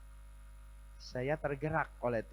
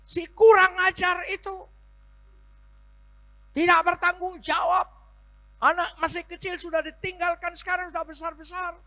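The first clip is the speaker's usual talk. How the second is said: very high, loud furious rant; 16 dB louder than usual. Retold or imitated. Abandoned wife raging at her husband, very high and loud. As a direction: shriek your rage at him.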